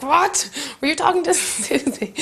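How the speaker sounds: high, startled mock paranoia with a laugh; jumpy paranoia. [name] startled when someone calls, high and jumpy. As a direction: overreact as if accused. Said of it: "I'm like".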